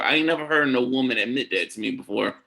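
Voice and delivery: usual speaking voice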